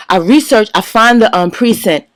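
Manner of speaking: ordinary delivery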